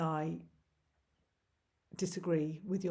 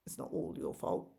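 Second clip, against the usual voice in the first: dropped to a near whisper, lower and softer; quiet teasing aside; 7 semitones lower, 6 dB quieter than usual. Shown as a demonstration. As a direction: slip it in under your breath as a dry aside. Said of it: "Most of it is, but".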